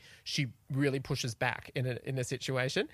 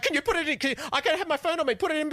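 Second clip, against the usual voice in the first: excited request; 11 semitones higher, 4 dB louder, faster than usual. Retold or imitated. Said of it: "she's like".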